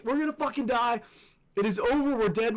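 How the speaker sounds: high, emphatic cries of doom; retold freak-out. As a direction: panic aloud, hammed-up. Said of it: "I start freaking out".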